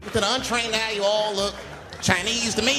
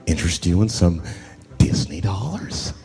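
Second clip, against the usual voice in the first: lower, loud, overly polite clerk voice; hotel clerk; 9 semitones lower, 4 dB louder than usual. Retold or imitated. Hotel desk clerk greeting the comedian, lightly voiced polite clerk. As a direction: lay on the customer-service charm, a bit deeper. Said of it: "As soon as I check in".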